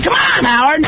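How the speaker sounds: high-pitched shout at the host; protesting shout. Real, not acted. Guest protesting as host ends the call, shouting.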